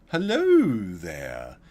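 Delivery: slow, drawn-out mock greeting; a hammed-up, lingering hello; imitating flamboyant neighbor. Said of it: "the way the guy said it was so flamboyant".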